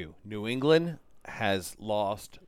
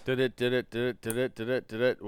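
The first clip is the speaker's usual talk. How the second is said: quick, repetitive mock news-alert sound; imitated news jingle; faster than usual. Filling in for the missing breaking news sound, mock jingle. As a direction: chant it like a breaking-news sting. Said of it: "We don't have that sound".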